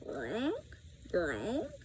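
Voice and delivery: soft gronk like a bell; a gentle raven gurgle; soft bird sounds. A narrator's reading. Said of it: "soft bell-like sounds".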